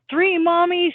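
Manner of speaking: high, loud mock disbelief; surprised question. Mom playing along, asking her toddler in loud surprise. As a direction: pitch it way up in amused surprise.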